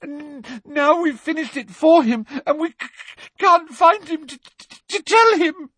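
high, stuttering fake fear; gasping, stammering. A narrator's reading. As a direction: gasp it out through stammers. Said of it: "gasped Hermione".

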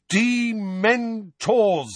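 very slow, high, clearly spelled out. A narrator's reading. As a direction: say it slowly and clearly. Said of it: "said Harry, slowly and clearly".

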